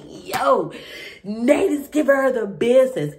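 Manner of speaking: loud, high excited outburst. Retold or imitated. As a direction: blurt it out, thrilled and teasing. Excited reaction to the dinner scene.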